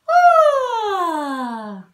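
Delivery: high yawning sigh sliding down. Shown as a demonstration. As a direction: open up and sigh like a big yawn. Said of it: "we're gonna do a yawn sigh".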